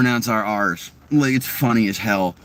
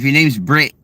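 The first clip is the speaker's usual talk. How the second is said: Australian "Brett" said as "Brit"; Australian accent. Shown as a demonstration. Showing how Australians say a name, Australian "Brett" as "Brit". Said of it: "Australians got that accent where".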